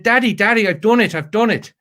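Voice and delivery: put-on high, eager little boy voice; a kid bursting in with news; excited child. Child voiced in retold story.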